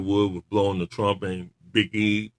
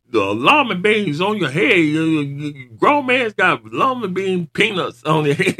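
higher, louder mocking rant with laughter; imitating the Rock; 9 semitones higher, 6 dB louder than usual. Imitating the Rock mocking New Day, loud laughing rant. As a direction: ham up the Rock's taunt. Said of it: "he said".

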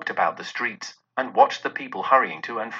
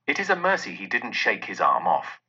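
plain reading voice

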